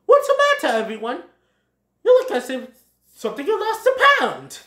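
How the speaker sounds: high, loud character voice; lively Thomas voice. Acted. Thomas checks on his worried friends, high lively voice. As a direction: pitch it way up and project.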